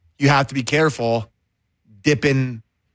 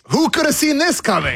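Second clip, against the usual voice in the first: high-pitched mock astonishment; sarcastic, pitched well up; sarcastic disbelief; 12 semitones higher than usual. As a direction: exclaim it as if nobody could have known. Sarcastic outburst at foreseeable trouble.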